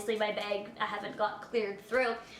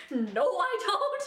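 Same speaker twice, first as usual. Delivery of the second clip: slow, high, laughing no I don't; laughing denial; 7 semitones higher, much slower than usual. Retold or imitated. She denies the taser while laughing. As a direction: deny it through laughter.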